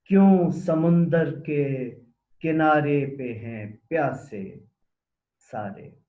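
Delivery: declaimed Urdu verse, questions launched firmly and left to fall; poem recitation. Acted. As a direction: recite the poem's questions with long pauses. Recites the poem's questioning last stanza. (सवालिया नज़्म पढ़ने का पुरज़ोर अंदाज़)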